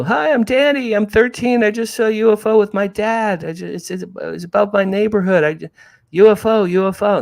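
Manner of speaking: put-on high, breathless thirteen-year-old, talking over himself; excited kid. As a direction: blurt it out like an overexcited boy on the phone. Kid calling newspaper, excited.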